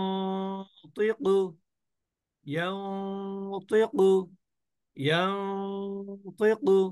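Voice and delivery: ইয়ানতিকু with a long level nasal before "tiqu"; ghunnah demo. Shown as a demonstration. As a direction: slowly hum the "n" inside ইয়ানতিকু. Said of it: "অনিশ্বারের মতন করে গুণনা করছি".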